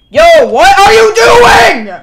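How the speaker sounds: loud, high, drawn-out wail; exasperated yell. Real, not acted. Streamer scolding a character in the game, drawn-out exasperated yell.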